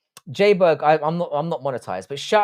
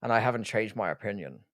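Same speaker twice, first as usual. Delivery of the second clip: a quiet, understated Connery impression; imitating Sean Connery; 5 semitones lower, 9 dB quieter than usual. Fan imitates Connery for viewers, softly and low. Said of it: "I love how he goes".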